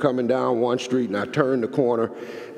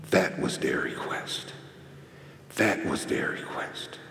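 emotional hush; 6 semitones lower, 8 dB quieter than usual. Real, not acted. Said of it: "I still sometimes get emotional about this".

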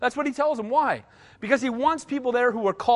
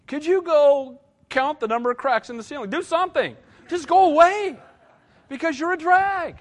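higher, mock-sweet brush-off; exasperated dismissal; 7 semitones higher, 4 dB louder than usual. Pastor sending a complaining traveler off to count cracks, mockingly. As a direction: shoo them away like a nuisance. Dismissing whiner on mission trip.

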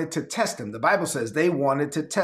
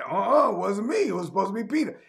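put-on higher-pitched childish denial; mock whiny denial; 5 semitones higher, faster than usual. Pastor voicing Matthew dodging blame, mildly higher. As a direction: pass the blame like a kid. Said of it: "And Matthew's like".

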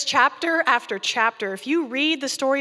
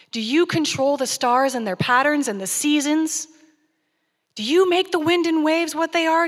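loud, higher, slowing on please; God's mock-sarcastic challenge, loud and high; God grills Job with loud sarcasm; booming God; 4 semitones higher, 5 dB louder than usual. God challenges Job, rising louder.

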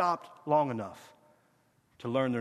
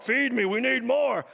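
higher, drawn-out mock demand; mock demanding cry; 7 semitones higher, 4 dB louder than usual. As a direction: clamour for more, imitating the needy. Mimics demanding believers.

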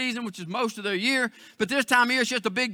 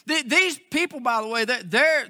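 usual speaking voice

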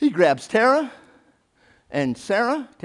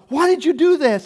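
high loud demand; 12 semitones higher, 10 dB louder than usual. Retold or imitated. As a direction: shout at your son. Father demands, acted quote.

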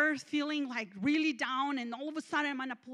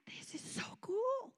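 high, hushed, slow delight; airy, drawn-out wonder; joyful awe; 11 semitones higher, 6 dB quieter, slower than usual. Retold or imitated. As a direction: marvel in a soft high voice. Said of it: "they're joyful".

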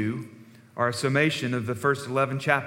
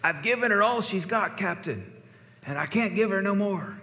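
Scotty's Scottish plea; 7 semitones higher than usual. Retold or imitated.

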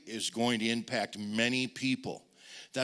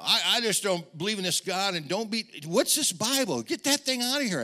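rising, louder, higher dismissal; scornful rant; 7 semitones higher, 4 dB louder than usual. Retold or imitated. Greg mocking the preacher's Bible, rising angry scorn. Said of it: "But he said".

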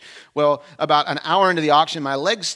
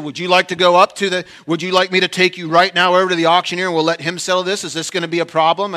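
threatening challenge; 3 semitones higher, 8 dB louder than usual. Retold or imitated. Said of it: "He said".

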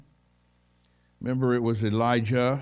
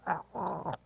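high, softer choking gags; mock choking; 7 semitones higher, 7 dB quieter than usual. Preacher mimics choking on a peanut butter sandwich, mock choking. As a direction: gag as if food is stuck in your throat. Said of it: "choke yourself trying to talk".

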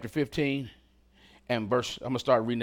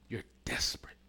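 whispered for emphasis, much softer; dramatic whisper; 5 semitones lower, 9 dB quieter than usual. Real, not acted. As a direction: drop to a hushed whisper. Dramatic hush on key phrase.

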